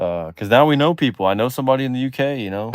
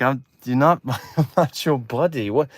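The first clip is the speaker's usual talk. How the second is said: baffled mock British judge; lightly hammed-up British indignation; Simon Cowell. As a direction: object in a put-on British accent. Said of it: "And he's like".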